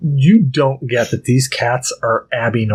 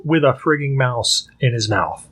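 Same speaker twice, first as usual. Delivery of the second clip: ordinary talk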